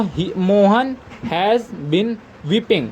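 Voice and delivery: very slow, word by word; measured dictation pace. Shown as a demonstration. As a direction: give the class time to write each word. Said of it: "teacher dictating finished sentence".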